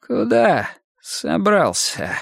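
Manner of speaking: slow hoarse menace; hoarse, slow threat. Acted. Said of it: "хрипло выговорил он".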